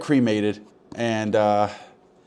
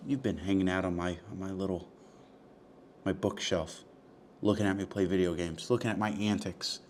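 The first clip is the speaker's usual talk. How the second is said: soft talk to ashes; 2 semitones lower, 8 dB quieter than usual. Real, not acted. Talking softly to the ashes.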